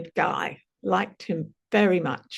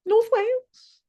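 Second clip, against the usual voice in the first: excited echo; 14 semitones higher than usual. Retold or imitated. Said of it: "And I just went".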